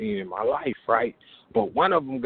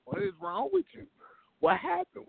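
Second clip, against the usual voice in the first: much higher, alarmed what the fuck is wrong with you; shocked disbelief; 3 dB quieter than usual. Retold or imitated. Teller to swollen friend, high shocked alarm. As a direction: react like your friend's face just blew up.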